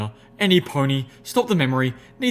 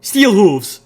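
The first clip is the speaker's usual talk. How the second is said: much higher, louder bark; barked order; 8 semitones higher, 11 dB louder than usual. A narrator's reading. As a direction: bark his name like a commander. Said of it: "Applejack barked".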